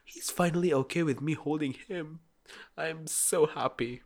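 overjoyed, marvelling at a tiny milestone; gushing proud parent. Acted. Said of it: "I'm, like, a proud parent".

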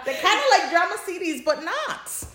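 giggling, high and fast; laughing high. Real, not acted. Friend laughing about podfics, high giggling. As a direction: squeal it through a laugh.

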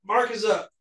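ordinary talk, nothing marked

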